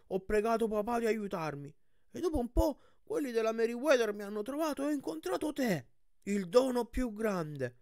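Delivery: high, guileless cyclops voice pouring out his story; high character voice, moved and earnest; earnest young cyclops, tearful story. Acted.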